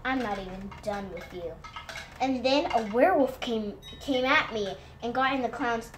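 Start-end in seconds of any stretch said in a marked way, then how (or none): 0.0-1.5 lightly voiced hidden menace, low and soft; a hint of a lurking voice, dropped low; low, quiet storybook threat; mysterious voice. A narrator's reading. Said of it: "heard a voice say".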